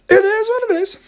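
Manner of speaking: high sing-song "It is what it is"; high mock shrug. Real, not acted. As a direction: toss it off high and resigned. Repeat high and exaggerated.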